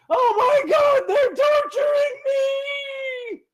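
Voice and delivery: very high, very loud, dragged-out wailing scream; high-pitched child screaming. Acted. Tortured little boy screams from inside the cave. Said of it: "very, very loud, high-pitched".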